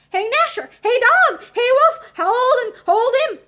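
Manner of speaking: loud, high commanding yells; shouting at dogs. A narrator's reading. Old servant sets dogs on him.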